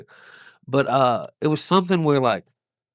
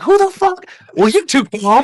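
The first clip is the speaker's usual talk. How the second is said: high, loud panicked outburst; panicking; 10 semitones higher, 6 dB louder than usual. Retold or imitated. Panicking comic turning on the calm bystander, high loud yelling. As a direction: re-enact a panicked yell, pitch shooting up. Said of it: "when you're panicking and you're like".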